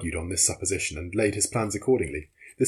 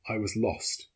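plain reading voice

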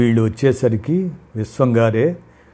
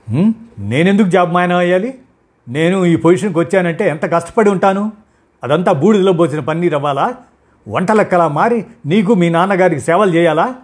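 higher, louder furious shout; 7 semitones higher, 5 dB louder than usual. A narrator's reading. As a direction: scream back even harder. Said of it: "అంతకన్నా గట్టిగా అరిచింది". (మరింత గట్టిగా అరుస్తూ)

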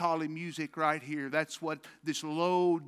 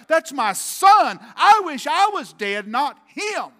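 loud, high anguished wail; a father crying out for his dead son; 9 semitones higher, 14 dB louder than usual. Retold or imitated. Preacher voicing David, crying out his grief loud and high. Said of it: "he's like".

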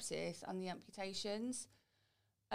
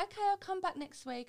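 lightly mocked psychologist, pitched much higher and louder; a hint of a chirpy professional, voiced up high; mimicking the psychologist; 12 semitones higher, 5 dB louder than usual. [name] retells the psychologist answering her brush-off, high mock-chirpy voice.

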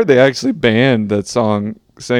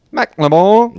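British accent; 6 semitones higher, slower than usual. Shown as a demonstration. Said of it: "And [name] sounds".

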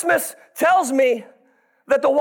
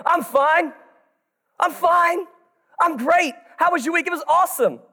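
fake cheer; 5 semitones higher than usual. Fake cheer at church greeting.

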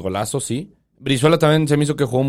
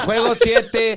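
high, laughing through the words; can't stop laughing while talking; 6 semitones higher, slower than usual. Real, not acted. Laughing at a friend's slip.

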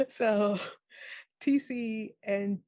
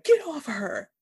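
higher, louder mock shout; yelling TC; 7 semitones higher, 5 dB louder than usual. Retelling TC defending Jen, mock louder shout. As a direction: bark it like a protective friend. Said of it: "He's like".

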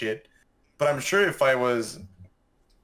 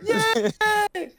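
high-pitched pretend yay; jokey little hooray, way up high; mock cheer. Joking mock cheer for buddies fighting.

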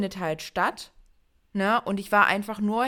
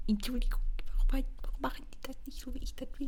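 fast annoyed whisper; 5 semitones higher, 5 dB quieter, faster than usual. Real, not acted. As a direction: whisper it fast under your breath. Muttering at the mic guard.

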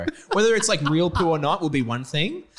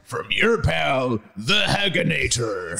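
slow, drawn-out gruff-giant impression; Hagrid; slower than usual. Co-host signs a note as Hagrid, in his voice. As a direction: sign off slowly as the character. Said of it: "please say it in the Hagrid voice".

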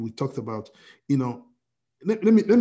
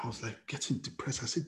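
usual speaking voice

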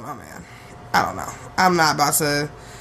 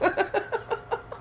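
high, quiet giggling fit; helpless laughter; 13 semitones higher, 10 dB quieter than usual. Real, not acted. Cracks up at the picture.

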